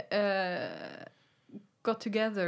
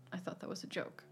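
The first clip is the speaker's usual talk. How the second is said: whispered quote; 11 dB quieter, faster than usual. Retold or imitated.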